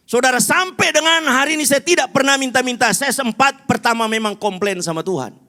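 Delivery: high, loud pulpit testimony; full-force preaching. Real, not acted. Preacher testifying to the congregation he never begged, full-force preaching. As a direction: proclaim it loudly to the whole hall.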